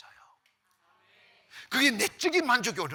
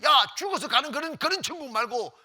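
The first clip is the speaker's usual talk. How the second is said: high, loud jeering yell; imitated yelling; 5 semitones higher, 7 dB louder than usual. Communist orders the pastor to show heaven now, yelling. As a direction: bellow it like a mocking captor. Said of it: "막 감을 지르는 거예요".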